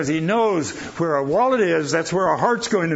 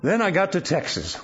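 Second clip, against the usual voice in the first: ordinary talk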